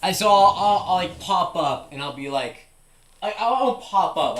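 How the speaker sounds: ordinary delivery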